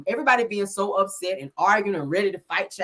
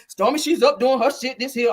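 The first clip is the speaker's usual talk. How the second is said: normal talking voice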